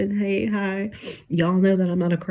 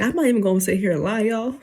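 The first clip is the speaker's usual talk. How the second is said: ordinary delivery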